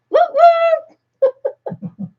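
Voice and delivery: high "woo" cheer breaking into laughter; excited whoop. Real, not acted. Host celebrating four journal winners, excited whooping. Cheering the extra giveaway prizes.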